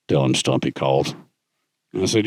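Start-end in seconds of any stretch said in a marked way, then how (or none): 0.1-1.1 put-on gravelly old Marine. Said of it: "it's this gravelly voice".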